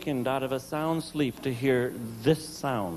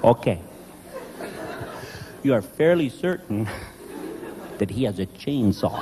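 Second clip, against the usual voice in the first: mock patience; 5 semitones lower than usual. Husband answering his wife, lower, mock-patient and dry. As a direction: humour her with low exaggerated patience.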